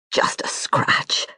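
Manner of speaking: slow, whispered through the pain; strained whisper. Acted. Wounded brother dismissing his injury, whispered. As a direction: shrug it off under your breath.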